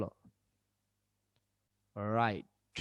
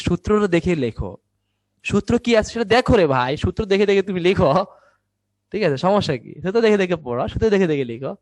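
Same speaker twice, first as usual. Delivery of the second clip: loud, raised, insistent teacher's plea; exasperated urging; 4 semitones higher, 6 dB louder than usual. Real, not acted. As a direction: push the class hard to follow instructions. Teacher urging failed students to use rule. (জোর গলায় বিরক্ত তাগাদা)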